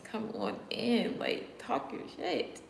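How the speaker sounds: slow, high goading taunt. Real, not acted. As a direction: dare them to come at you. Taunting trolls in chat.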